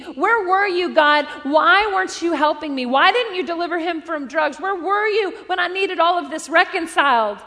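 loud, pleading questions to God; anguished crying out to God. Retold or imitated. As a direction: shout your why at heaven.

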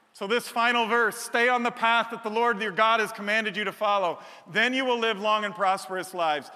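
loud, driving preaching to the crowd; full-force preaching. Acted. Pastor driving home the glory-of-God point to the congregation, preaching loud. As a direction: preach it hard, building on each phrase. Building sermon climax.

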